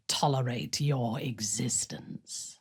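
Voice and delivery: slow, soft whispered disdain; contemptuous whisper. Acted. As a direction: let the scorn drop to a whisper. Contemptuous aside.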